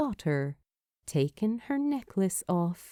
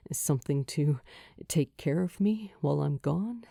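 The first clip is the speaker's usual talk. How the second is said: echoing back; 5 semitones lower, faster than usual. Acted. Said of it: "he echoes back".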